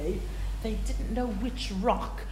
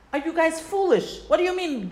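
incredulous rebuke; 8 semitones higher than usual. Retold or imitated. Rebuking the impatient people.

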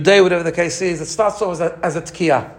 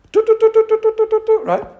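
truah staccato blasts. Shown as a demonstration. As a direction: fire off short high shofar toots. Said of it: "Or is what we call truah".

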